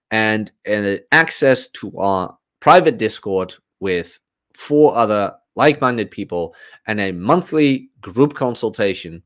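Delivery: usual speaking voice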